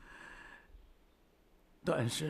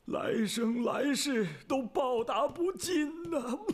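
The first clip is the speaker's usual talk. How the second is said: higher, swelling emotional declaration; agitated gratitude; 7 semitones higher than usual. Acted. Ailing general thanks his emperor for a lifetime's favour, agitated. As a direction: pour out overwhelming gratitude to your sovereign. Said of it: "别激动".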